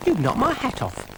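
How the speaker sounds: high, put-out snail voice; indignant little snail. A narrator's reading. Brian the snail protests about his hat, high indignant voice. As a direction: complain in a high little pipe. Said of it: "said Brian".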